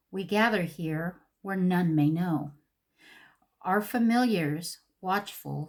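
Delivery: slow, measured verse reading with long pauses; poem recital. Acted. Vlogger reading the palette's witchy poem to viewers, measured recital. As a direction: read it line by line like a spell.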